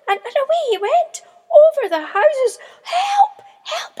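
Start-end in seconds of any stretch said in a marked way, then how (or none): 2.8-4.0 very high, loud terrified cry; crying for help. A narrator's reading. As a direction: scream for help as the wind takes you. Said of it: "he cried".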